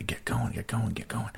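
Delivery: hushed, repetitive muttered encouragement; urging under breath. Retold or imitated. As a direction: quietly egg her on. Urging her on under his breath.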